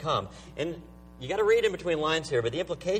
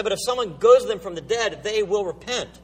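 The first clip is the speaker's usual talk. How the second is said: higher, insistent acted protest; heated, mildly hammed-up insistence; belligerent; 6 semitones higher, 5 dB louder than usual. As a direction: argue back as the angry rich man. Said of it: "He becomes angry".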